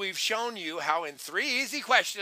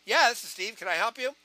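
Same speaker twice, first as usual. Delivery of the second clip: higher, brisk phone answer; upbeat callee; 4 semitones higher, 3 dB louder, faster than usual. Shown as a demonstration. Steve answering a caller, high and upbeat. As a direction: pick up with bright energy. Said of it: "You need to come up".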